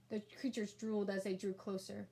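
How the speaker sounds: plain reading voice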